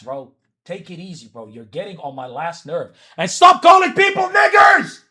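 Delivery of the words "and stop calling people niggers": real outburst, top of the voice; angry shout. Real, not acted. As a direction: yell it high and furious. Heated argument in chat.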